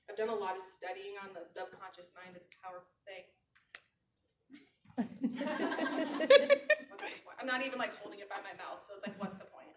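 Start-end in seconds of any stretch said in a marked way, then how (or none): 4.9-6.9 high, loud burst of laughter; real laughter. Real, not acted. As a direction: burst out laughing, pitch shooting up. Amused by her own mishap.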